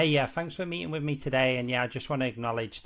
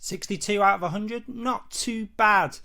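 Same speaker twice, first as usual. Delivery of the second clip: upbeat score reveal; 7 semitones higher, 7 dB louder than usual. Real, not acted. Reading out his score.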